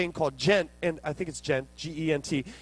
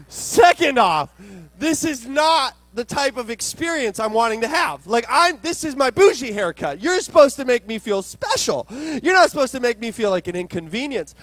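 loud, high, hammed-up outrage; indignant tirade; 6 semitones higher, 7 dB louder than usual. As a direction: play the offended customer at full volume. Acting out his outrage at receptionist.

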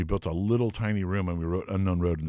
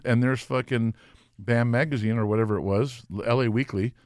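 ordinary delivery

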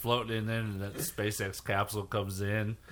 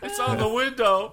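mock panic; 12 semitones higher, 4 dB louder than usual. Acting astronauts in a mess.

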